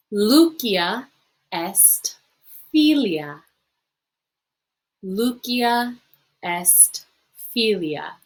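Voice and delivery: slow, syllable by syllable, for the class to repeat; slow model reading. Shown as a demonstration. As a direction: read it out slowly so students can copy. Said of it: "practice saying it yourself".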